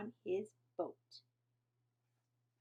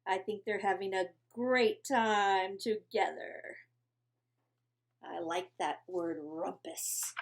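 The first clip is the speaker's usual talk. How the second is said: ordinary delivery, nothing marked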